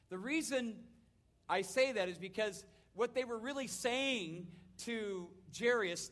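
usual speaking voice

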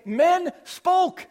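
"Men spoke." lifted high and loud on spoke; English word order read with punch; stress on Men spoke. Shown as a demonstration. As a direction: hit "Men spoke" hard. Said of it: "And we would say".

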